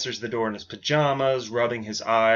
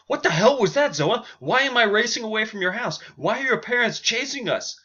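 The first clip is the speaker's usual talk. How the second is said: freaking out; 10 semitones higher than usual. Acted. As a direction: shout in alarm while racing away. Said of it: "she's freaking out".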